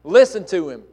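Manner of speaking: loud repeat. Shown as a demonstration. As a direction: call it out so all listen. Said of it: "And he goes on to say".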